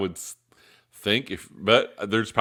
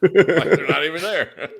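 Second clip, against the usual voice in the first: high giggling laugh; 8 semitones higher, 8 dB louder than usual. Real, not acted. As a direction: laugh through the words. Joking about deleting bad opinions.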